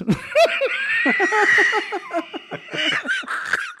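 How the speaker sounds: high cackling laugh; loud high laugh. Real, not acted. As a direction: burst out laughing. Laughing at own joke.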